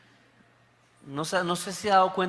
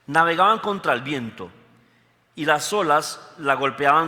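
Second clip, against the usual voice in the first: louder dramatic Bible reading; scripture reading; 4 dB louder than usual. Acted. Preacher reading Matthew to the congregation, dramatic reading. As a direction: read the passage with rising drama. Reads Bible passage aloud.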